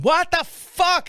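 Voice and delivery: very high, stretched-out mock yell; drawn-out exasperated cry at contained volume; retold yell. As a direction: re-enact yelling at the dog, pitch way up. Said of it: "I'm yelling".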